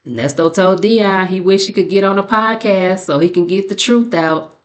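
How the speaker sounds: ordinary talk, nothing marked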